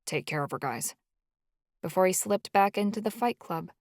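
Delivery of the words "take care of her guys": softer, low mumble; mumbled. A narrator's reading. Charlie mumbling to friends as he leaves, soft and low. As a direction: mumble it while turning away. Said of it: "Charlie turned his back and mumbled".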